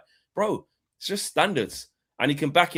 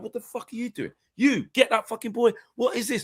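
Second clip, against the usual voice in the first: raised, angry barking at teammates; Roy Keane in this team, raging at teammates, voice pitched up; imagined furious captain; 8 semitones higher than usual. Pretend. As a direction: tear into the lazy players. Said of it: "be like".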